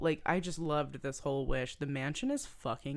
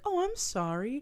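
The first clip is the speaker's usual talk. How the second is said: enticing voice; 9 semitones higher than usual. Retold or imitated. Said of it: "she's still like".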